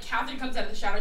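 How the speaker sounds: ordinary delivery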